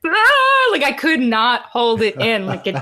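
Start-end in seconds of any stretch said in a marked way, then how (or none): 0.1-0.7 sudden high loud wail of release; cry of release. Retold or imitated. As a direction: let it burst out as a high cry. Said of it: "I was like".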